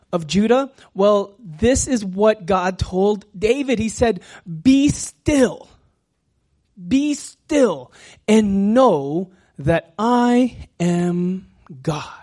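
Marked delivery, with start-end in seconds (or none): 4.5-12.1 loud, slow, weighty proclamation; proclaimed scripture. Acted. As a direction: proclaim it as God's command, stretching the words. Reading God's command to David.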